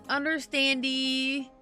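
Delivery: ordinary talk, nothing marked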